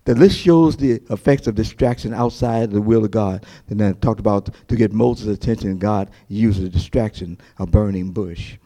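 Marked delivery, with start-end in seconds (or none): none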